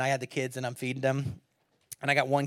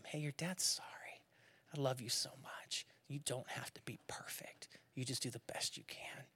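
put-on soft whispered apology to a child; dad whispering sorry to his son, tender; 3 semitones lower, 17 dB quieter than usual. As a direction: hush it like a bedside comfort. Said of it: "comfort him".

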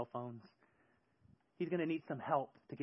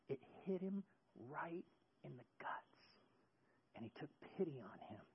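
hushed; 11 dB quieter than usual. Shown as a demonstration. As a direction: drop to a breathy hush for effect. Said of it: "preacher drops to a whisper".

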